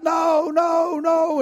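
high, pleading cry of refusal; frightened man's high shout; terrified scream. Acted. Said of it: "the man screamed out".

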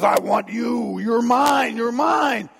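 loud, possessive devil shout; Satan roaring out his claim; menacing Satan. Acted. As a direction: claim your prey at full voice. Satan demands the man at the door.

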